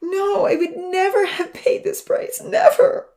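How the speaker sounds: loud, high, drawn-out anguish; anguished cry at full force; sobbing outburst. A narrator's reading. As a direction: wail it, climbing to a cry on the last word. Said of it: "And then he began to sob".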